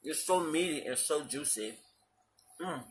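muffled words between bites; talking while chewing. Real, not acted. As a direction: mouth partly full of food. Seen 1: bit at -2.0 to -1.0, chews and talks after.

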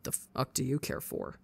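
quiet, low and seething. A narrator's reading. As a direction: hiss it through the teeth, low and soft. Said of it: "words low and seething".

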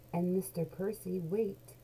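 ordinary delivery, nothing marked

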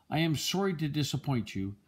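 ordinary delivery, nothing marked

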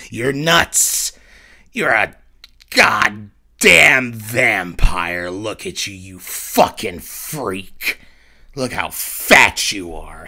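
loud slow insults; angry insults. Acted. Mirror self insulting Mars, loud slow contempt. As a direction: spit out each insult.

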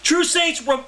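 poetry reading. Acted. Poet reading his poem to an open mic crowd, declaiming. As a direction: read it to the room like a performance poem.

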